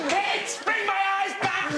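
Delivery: high, slow shouting horse. Pretend. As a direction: call out slowly like a distraught horse. Horse voiced in photo.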